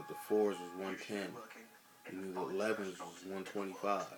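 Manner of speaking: ordinary talk, nothing marked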